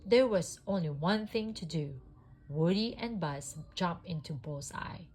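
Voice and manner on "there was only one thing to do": ordinary delivery, nothing marked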